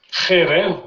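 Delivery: "Kherev" begun with a back-of-throat kh; chet sound. Shown as a demonstration. Teacher correcting a student, throaty kh opening "Kherev". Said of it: "c'est un chet".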